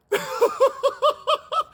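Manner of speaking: bursts out in a high laugh; a real high-pitched laugh from the pulpit. Real, not acted. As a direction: laughs at Elijah's mockery. Laughing at the clowning.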